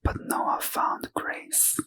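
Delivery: usual speaking voice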